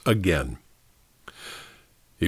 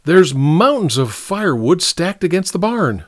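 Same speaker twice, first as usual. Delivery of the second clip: higher-pitched exasperated objection; indignant protest; 7 semitones higher than usual. A narrator's reading. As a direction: protest at pointless work. Nicky protesting the chopping.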